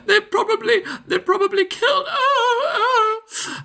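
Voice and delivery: high-pitched, hammed-up tearful panic; panicked woman. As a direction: play a distraught woman pleading and wailing for help. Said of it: "you see a bit of panic in her face".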